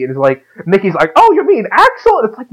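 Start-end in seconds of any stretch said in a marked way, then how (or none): 1.2-2.2 high, clueless mock Mickey. Friend mocking Mickey's clueless question in a high voice. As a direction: squeak it like an oblivious Mickey.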